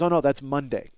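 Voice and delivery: normal talking voice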